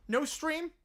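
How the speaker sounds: ordinary talk, nothing marked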